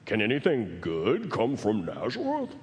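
smug, low, self-righteous sneer. Retold or imitated. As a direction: look down your nose at Nazareth. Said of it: "They probably even had that voice".

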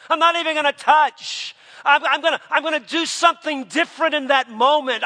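high, loud, fast vow shouted out; Job's vow shouted. Retold or imitated. Preacher voicing Job to the congregation, full shout. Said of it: "That's like Job said".

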